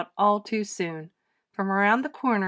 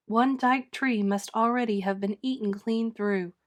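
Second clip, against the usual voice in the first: usual speaking voice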